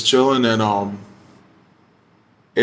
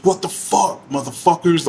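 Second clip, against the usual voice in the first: retold taunt, pitched up high and hammed up; mock angry challenge; 5 semitones higher than usual. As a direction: toss it out like a half-acted dare to pick a fight. Retold fight challenge at the fire.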